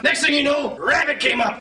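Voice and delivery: ordinary delivery, nothing marked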